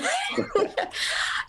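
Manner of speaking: sudden squeal of laughter; a high delighted laugh bursting out. Real, not acted. Guest laughing at the host's twin joke, high squealing laugh. Host jokes about her twin.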